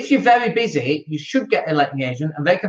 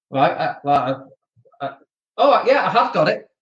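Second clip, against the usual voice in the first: sheepish stalling; 2 semitones lower than usual. Retold or imitated. Acted evasive reply about unpaid text.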